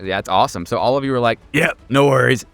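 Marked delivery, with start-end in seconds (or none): none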